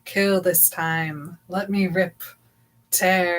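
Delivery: slow, hushed menacing voice; creepy disembodied voice, quiet and slow; scary voice. Acted. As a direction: draw it out softly like a lurking threat. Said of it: "read what the voice says? It's so scary".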